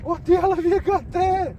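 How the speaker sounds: yelling in disbelief at the catch; surprised shout. Real, not acted. Shouting to his mate about his catch, surprised.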